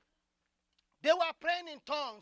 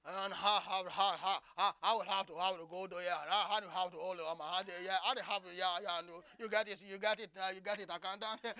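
Southern drawl imitation; 4 semitones lower, faster than usual. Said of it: "listened to a real Southern man talk".

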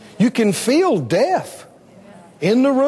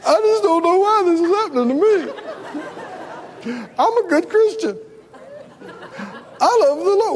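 high, whining self-pity; mock a pitiful believer crying to God; self-pitying whine; 8 semitones higher than usual. Preacher mocking a self-pitying believer, whiny and high. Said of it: "you can go lay down and go".

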